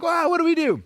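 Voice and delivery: mock panic. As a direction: squeal it like the leads just vanished. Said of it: "we're freaking out, like".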